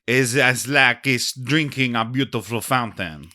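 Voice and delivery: lightly done French waiter; mild French waiter accent; thick French accent. Acted. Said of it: "The waiter has a very thick French accent".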